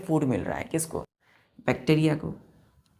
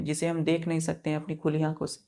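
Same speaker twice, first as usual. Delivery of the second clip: ordinary talk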